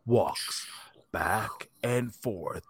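in a world, deep and grave; movie trailer voice. Retold or imitated. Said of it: "it was like the movie trailer voice".

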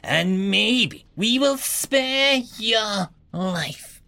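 high, slow, haughty voice; proud. Acted. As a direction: sneer down from above. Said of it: "the noble said proudly".

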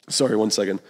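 ordinary delivery

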